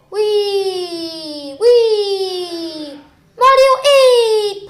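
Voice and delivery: high, loud, drawn-out squeal; playful whooping. Pretend. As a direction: squeal it out long and high like on a ride. Playful cheering while playing.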